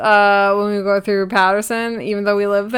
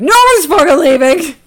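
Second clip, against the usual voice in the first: higher, louder imitated command; mock shouted order; 8 semitones higher, 8 dB louder than usual. Imagined authority barricading school doors, loud mock order. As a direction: bellow it like you've barricaded the doors.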